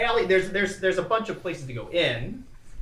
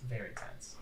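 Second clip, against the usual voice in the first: hushed golf announcer voice; quiet, reverent golf commentary; golf commentator; 3 semitones lower, 12 dB quieter than usual. Acted. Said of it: "I'll be talking in a golf, uh, voice here".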